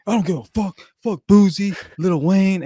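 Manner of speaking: high, loud imitation of a stranger yelling at him; mock yelling stranger. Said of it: "he just starts yelling at me".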